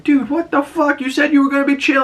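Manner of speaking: high-pitched, incredulous imitation; mock appalled protest. Acting appalled woman confronting the shooter.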